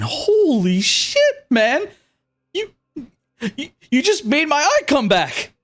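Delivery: high-pitched, stunned disbelief; blown-away bandit in a high voice; amazed bandit. Acted. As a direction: gasp it out high, drawn out, astonished. Bandit amazed his eye healed.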